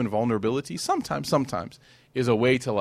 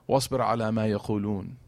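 Quran recitation; 3 semitones lower, 5 dB quieter, much faster than usual. Acted.

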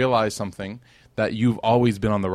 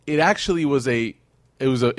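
normal talking voice

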